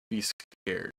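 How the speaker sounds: normal talking voice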